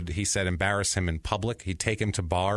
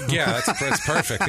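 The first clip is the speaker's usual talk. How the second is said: laughing; 7 semitones higher, 4 dB louder, slower than usual. Real, not acted. Laughing at a dark joke.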